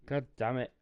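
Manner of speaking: loud, annoyed swear; frustrated curse. Real, not acted. Frustrated outburst at game.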